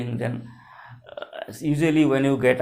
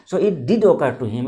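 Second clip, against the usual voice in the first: did hit loud; louder, landing on did; stress on did; 5 dB louder than usual. Shown as a demonstration. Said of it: "This did is called emphatic do".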